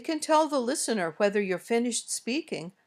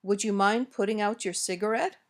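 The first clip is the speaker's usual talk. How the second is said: yes-no question rise on "cigarette"; rising request intonation. Shown as a demonstration. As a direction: lift the end of "cigarette". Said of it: "These usually go up at the end".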